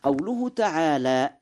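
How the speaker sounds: ordinary talk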